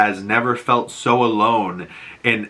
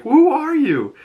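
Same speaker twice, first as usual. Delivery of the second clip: much higher, accusing outburst; angry demand; 3 dB louder than usual. Retold or imitated. Achilles confronting Memnon as a stranger, high angry demand.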